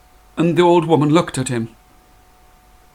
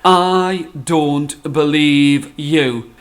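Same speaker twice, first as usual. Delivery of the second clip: slow, flat, sceptical wife; hands-on-hips wife refusing to believe; disbelieving; 3 dB louder, much slower than usual. Acted. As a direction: drag each word out, unconvinced. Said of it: "put her hands onto her hips, looked at him and said".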